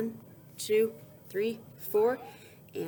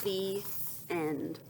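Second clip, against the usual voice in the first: plain reading voice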